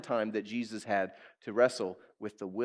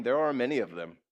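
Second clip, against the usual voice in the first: normal talking voice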